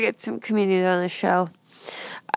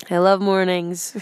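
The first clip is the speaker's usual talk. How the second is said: mock affirmation; 6 dB louder than usual. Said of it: "now we're gonna say".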